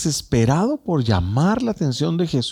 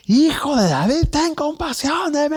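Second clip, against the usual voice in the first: desperate shout. Retold or imitated. Bartimaeus crying to Jesus over the crowd, desperate shout. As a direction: cry out with all his strength. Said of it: "Fue un grito con todas sus fuerzas".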